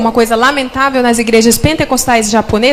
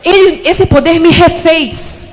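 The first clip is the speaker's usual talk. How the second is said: fiery preaching; 5 semitones higher than usual. Acted. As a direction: preach with conviction. Preaching at full force.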